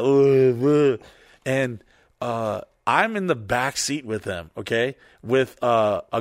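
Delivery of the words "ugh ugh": loud mock drunken groan. Imitating a drunk friend in the car, loud slurred groan. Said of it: "he's just like".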